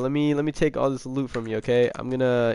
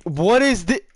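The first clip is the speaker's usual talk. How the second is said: higher, louder incredulous outburst; shocked disbelief; 5 semitones higher, 3 dB louder than usual. Real, not acted. Streamer reacting to viewers' skins, incredulous. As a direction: react as if you can't believe it.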